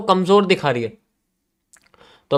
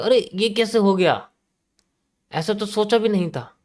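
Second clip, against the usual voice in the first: higher, slow, shocked "अरे" then disbelief; surprised buyers; 6 semitones higher than usual. Retold or imitated. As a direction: play a stunned buyer. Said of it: "buyers कहेंगे".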